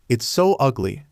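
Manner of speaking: usual speaking voice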